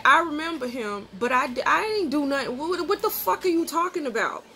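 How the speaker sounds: incredulous pushback, acted out; retold indignant denial. Retold denial of his accusation.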